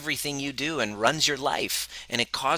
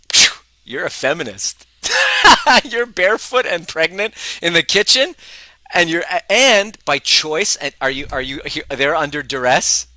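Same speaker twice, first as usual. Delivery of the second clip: louder, higher, laughing incredulity; excited teasing; 7 semitones higher, 8 dB louder than usual. Real, not acted. Husband teasing his pregnant feminist wife, loud and amazed. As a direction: tease her, loudly amazed.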